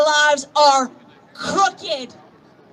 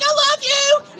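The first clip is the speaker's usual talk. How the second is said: high, excited burst of affection; gushing; 11 semitones higher than usual. Retold or imitated. Telling a stranger on the plane she loves him, high and gushing. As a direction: blurt it out like meeting a kindred spirit. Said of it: "I'm like".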